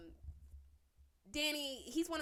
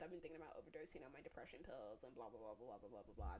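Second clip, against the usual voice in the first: lower, softer, dismissive parody of his sob story; lower, quieter sarcastic imitation; mocking mopey voice; 9 semitones lower, 10 dB quieter than usual. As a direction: drone it out mockingly. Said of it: "by being like".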